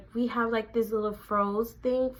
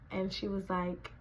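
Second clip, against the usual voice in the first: ordinary talk, nothing marked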